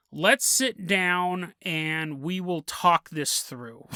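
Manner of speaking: slow, deliberate placating words; slow coaxing. Retold or imitated. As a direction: talk her down slowly. Boyfriend's slow persuasion.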